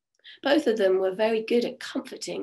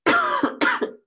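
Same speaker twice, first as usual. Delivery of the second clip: cough; 5 dB louder than usual. Real, not acted. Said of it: "my croaky voice".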